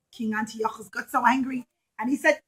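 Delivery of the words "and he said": plain reading voice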